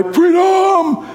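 high, long mock scream; imitated battle cry. Preacher quoting the cry mid-sentence, high mock scream. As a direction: burst into a drawn-out movie-hero cry.

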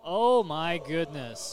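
loud, drawn-out astonishment; slow, booming oh-my-goodness amazement; amazed exclamation. Real, not acted. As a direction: react big to a huge pick, stretching it out. Amazed reaction to a draft pick.

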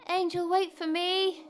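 high-pitched, urgent call. Acted. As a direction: call after someone who is leaving. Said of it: "I heard Mary calling".

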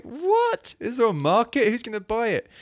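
high, squeaking disbelief on the What, still high after; mock the doubters with a shocked yelp; incredulous. People hearing about the Welsh kids album, incredulous high yelp.

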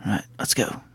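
hushed whisper while sneaking. Acted. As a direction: breathe it out, barely voiced. Sneaking in, opening a door.

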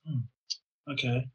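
teacher shrugging it off; soft shrug. Retold or imitated. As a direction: let it go quietly. Teacher's mild reaction.

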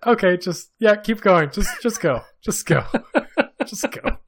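high, loud urging through laughter; laughing. Real, not acted. Telling cohost to keep going, laughing hard. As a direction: wave them on, helpless with laughter.

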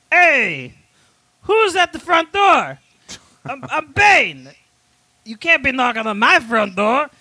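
high, drawn-out character voice; Bane. Acted. Demanding who is knocking at his door, as a high-voiced Bane. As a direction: call out slowly in a high put-on voice. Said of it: "And Bane goes".

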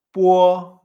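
loud, level "puo" with a w glide into the o. Shown as a demonstration. Teacher repeating the uo sound for learners, emphatic demonstration. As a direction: say "puo", rounding through u into a held o. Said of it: "una, un poco uo".

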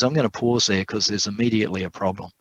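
ordinary talk

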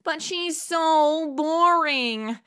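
whiny complaint. Acted. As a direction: drag it out in bored complaint. Complains on phone to editor.

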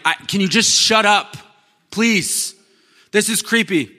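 high, loud mock exasperation; exasperated introvert. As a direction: introvert at a party, mock fed up. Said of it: "and you're like".

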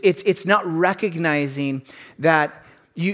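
ordinary talk

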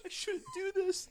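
high, slow whimpering regret; whiny regret. Retold or imitated. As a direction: whine it like you're about to cry. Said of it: "he goes".

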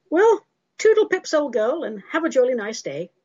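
high, hammed-up British cheerio; mock jolly farewell. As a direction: bid a chirpy, old-fashioned goodbye. Hammed-up British sign-off to the critic.

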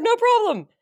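high mock agreement; high sarcastic. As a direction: brush off the neighbor, high. Drunk girl dismissing neighbor.

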